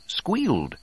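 plain reading voice

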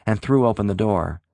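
ordinary delivery

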